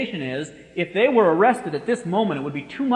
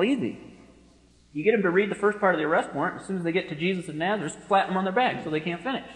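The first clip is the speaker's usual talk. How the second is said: ordinary talk, nothing marked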